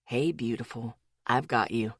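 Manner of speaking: low, soft and close reassurance; tender whisper. A narrator's reading. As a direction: whisper comfort to her. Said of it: "Ethan whispered to me".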